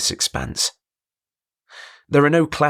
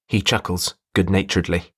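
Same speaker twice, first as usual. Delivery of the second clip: ordinary delivery, nothing marked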